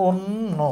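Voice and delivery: "অন্য" slowly as "on-no", double n; correct pronunciation, broken up. Shown as a demonstration. Teacher modelling for students, slow closed-o on-no. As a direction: hold the n of "অন্য", then release no. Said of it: "এর উচ্চারণ হবে".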